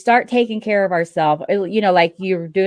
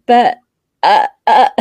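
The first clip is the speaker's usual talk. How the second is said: loud, halting stammer of protest; mock speechless sputtering; 2 semitones higher, 7 dB louder than usual. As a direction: sputter as if lost for a comeback. Acts out being lost for words.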